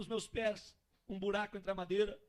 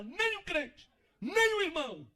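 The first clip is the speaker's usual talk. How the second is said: queixa forte em tom bem agudo; desabafo exaltado; 7 semitones higher, 6 dB louder, slower than usual. Acted. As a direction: clame a falta de visitas. Desabafo encenado do homem esquecido. (desabafando aos brados)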